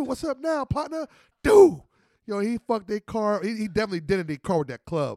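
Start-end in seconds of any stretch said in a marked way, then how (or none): 0.0-1.1 higher, punchy challenge; mock taunt. Friend to the other driver after the hit, higher taunt. Same taunt retold again. 1.4-1.8 much higher, loud "Dude"; loud exclamation. Real, not acted. To the listeners about the smashed car, loud.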